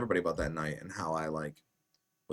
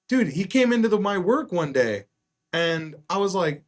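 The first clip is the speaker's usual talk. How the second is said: excited retelling; 10 semitones higher, 6 dB louder than usual. Retold or imitated. Friend's excited retold story.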